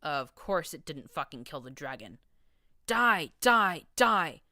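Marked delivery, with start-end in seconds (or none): 2.9-4.4 high, loud battle scream; furious repeated war cry. Acted. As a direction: scream it at the dragon. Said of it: "I screamed".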